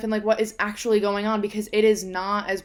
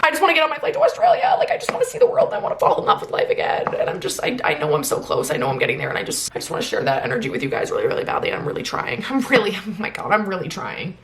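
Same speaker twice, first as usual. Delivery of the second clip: overwhelmed, near tears; 4 dB louder than usual. Real, not acted. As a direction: choke up while pouring your heart out. Emotional breakdown about really trying.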